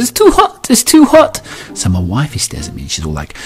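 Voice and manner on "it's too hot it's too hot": put-on very high, loud toddler wail; complaining toddler. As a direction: protest like an outraged tot. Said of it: "She goes".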